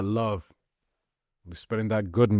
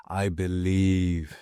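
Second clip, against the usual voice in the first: normal talking voice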